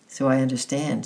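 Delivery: usual speaking voice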